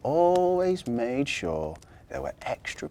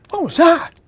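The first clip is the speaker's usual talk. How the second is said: put-on high, loud child voice; startled cry; 12 dB louder than usual. A narrator's reading. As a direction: cry out like a girl woken with a fright. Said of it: "cried Victoria".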